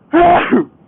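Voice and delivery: sudden sharp sneeze inside a helmet; loud sneeze. Real, not acted. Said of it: "Sneezing in a helmet is".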